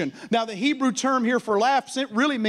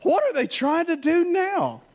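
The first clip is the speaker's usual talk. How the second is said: mocking; 9 semitones higher than usual. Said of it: "looking at his enemies like".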